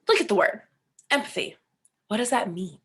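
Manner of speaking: ordinary delivery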